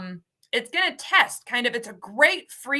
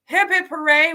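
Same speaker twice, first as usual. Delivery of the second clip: high, louder chant of hooray; cheer; 6 semitones higher, 6 dB louder than usual. Real, not acted. Host celebrating visa news, cheering.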